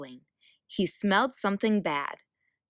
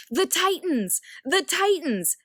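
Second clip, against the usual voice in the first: shouting; 10 semitones higher than usual. A narrator's reading. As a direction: raise the alarm across the field. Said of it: "shouted Zeus".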